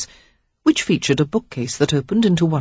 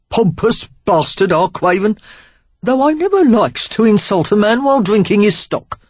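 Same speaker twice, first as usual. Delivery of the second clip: loud, higher Cockney jeer; mock the boss in broad Cockney; Cockney scorn; 5 semitones higher, 5 dB louder than usual. A narrator's reading. Stranger insulting Craven to Sarah, scornful Cockney. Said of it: "a healthy dose of Cockney in his accent".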